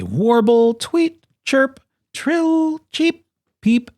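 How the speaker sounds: very high, piping voice; high-pitched playful bird-call words; birdlike chirping. A narrator's reading. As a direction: chirp each word like a little bird.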